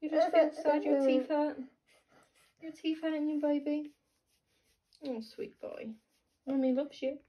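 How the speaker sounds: soft, gentle comforting; tender soothing. Real, not acted. Mum to grumpy teething baby, tender soothing.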